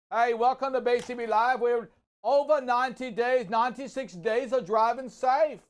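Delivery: hammed-up Aussie radio host welcome; a light put-on Australian twang on the show intro; mock Australian accent. Host restarting the show intro in a mock Australian accent. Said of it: "I forgot my own voice now".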